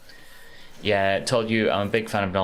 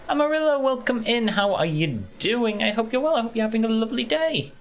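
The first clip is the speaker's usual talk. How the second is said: much higher, put-on sing-song welcome; high sing-song greeting. Streamer greets viewer Amarillo in a high sing-song voice.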